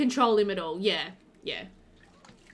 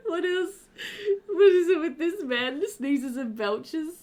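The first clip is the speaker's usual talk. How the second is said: laughing; 5 semitones higher than usual. Real, not acted. Still laughing about husband's sneezes.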